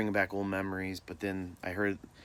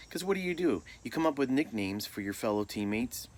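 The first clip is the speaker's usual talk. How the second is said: ordinary talk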